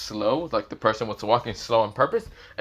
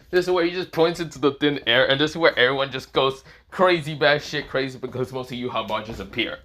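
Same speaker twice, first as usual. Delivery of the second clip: plain reading voice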